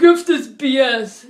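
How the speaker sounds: loud, defiant Jersey brush-off; Jersey attitude. Retold or imitated. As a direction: spit it out like a tough guy from Jersey. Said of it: "It's from Jersey".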